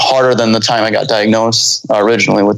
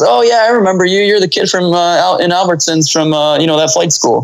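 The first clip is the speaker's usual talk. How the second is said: lightly voiced friendly pilot; 7 semitones higher than usual. Pilot remembers him on phone.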